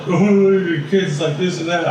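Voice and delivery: hammed-up dismissive nurse voice, high and slow; mocking the nurse.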